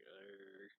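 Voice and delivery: low, dazed groan from someone half passed out; groggy groan. Retold or imitated. Drunk Yoichi mumbles a reply to Kimizuki, low dazed groan. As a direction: grunt it like you can barely wake up.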